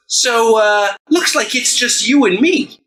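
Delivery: high, loud and slow menacing taunt; hammed-up showdown taunt; taunting wrestler voice. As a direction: draw it out as a threat. Rat Trap taunts Authority figure.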